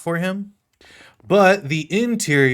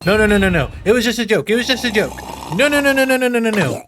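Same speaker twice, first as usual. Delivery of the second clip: high, loud frantic pleading; mock horror, backing away fast; hammed-up panic at a kiss; panicked protest; 6 semitones higher, 4 dB louder, faster than usual. Puppet panics about being kissed.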